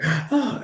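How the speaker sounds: put-on high gasping child's whimper; gasp, then let out a small high cry; imitated wail. Seven-year-old son to dad after hitting his head, mimicked wailing. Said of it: "he's wailing like".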